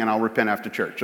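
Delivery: tossed off under the breath; mumbled joke to the congregation; quick quiet aside. Real, not acted.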